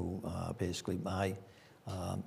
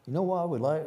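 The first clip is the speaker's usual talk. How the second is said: higher, louder Southern lilt; Southern accent; 8 semitones higher, 5 dB louder than usual. Retold or imitated. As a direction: quote her in a Southern accent. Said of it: "she had this delightful Southern accent".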